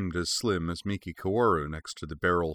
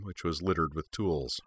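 ordinary delivery, nothing marked

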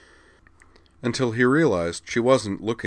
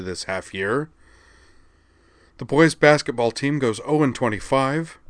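aggrieved complaint; 5 semitones higher, 4 dB louder than usual. Acted. Trading complaints.

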